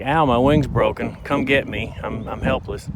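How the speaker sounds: playfully voicing a pretend-hurt bird; whiny helpless plea for the predator; helpless injured bird. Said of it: "she should be doing the broken wing thing".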